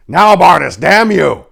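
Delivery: loud, high, furious yell; furious shout. Acted. Paladin cursing the knight, yelling furiously. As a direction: rage at the hesitating knight. Dorn furious order again.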